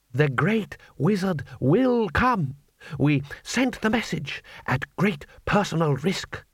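slow, solemn conspirator voice; a hint of a grave cadre leader; earnest revolutionary. A narrator's reading. Red Army cadre speaks.

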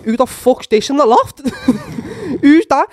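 high, loud startled question; alarmed shout. Retold or imitated. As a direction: retell it as a shocked challenge. Acting out alarm at unknown figure.

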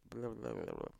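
ordinary talk

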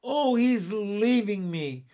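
high, loud panicked cry, short of full shriek. A narrator's reading. Miss Lark seeing Andrew depart, a restrained shriek. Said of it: "shrieked Miss Lark".

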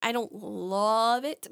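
ordinary talk

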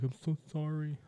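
mock pleading apology. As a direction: grovel like a pushover.

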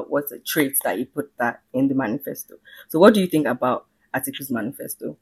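ordinary talk, nothing marked

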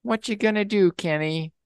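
high-pitched questioning voice; high squeaky sidekick. Acted. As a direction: pipe it up high. Duck voiced again, acted quote.

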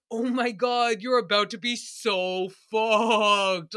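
slow, drawn-out hammed-up warning; mock dire warning. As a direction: stretch it out like a gleeful doom warning. Voices parents warning pregnant women.

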